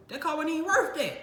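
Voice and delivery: high, loud indignant protest; outraged disbelief. Retold or imitated. Told the bill by insurance, a high indignant protest. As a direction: squeal at the price.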